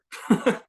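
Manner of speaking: brief, loud burst of laughter; short laugh. Real, not acted. Amused aside to the group.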